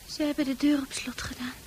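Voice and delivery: hushed unvoiced whisper. [name] whispers about the locked door. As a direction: whisper it like a secret.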